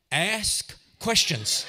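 deliberate pause between the two words; slow, spaced advice. Real, not acted. Comic preacher advises the audience, slow and spaced. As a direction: draw out each word slowly.

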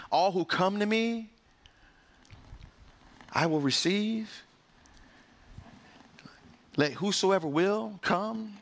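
high, ringing preacher's call, building; fervent preaching. Acted. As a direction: lift the room with the gospel invitation. Preaching builds to full force.

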